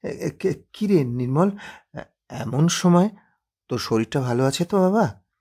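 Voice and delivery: a flustered, stammering mother; flustered surprise. Acted. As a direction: caught off guard, fussing over her son. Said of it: "শশব্যস্তে হাতের কাজ সরিয়ে বলে ওঠেন".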